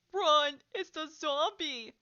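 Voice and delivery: loud, high taunting yell; full-voiced shout of mock alarm. A narrator's reading. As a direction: yell it out slow, as if raising the alarm. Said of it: "someone yelled".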